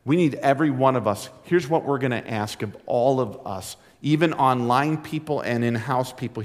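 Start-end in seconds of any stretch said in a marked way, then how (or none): none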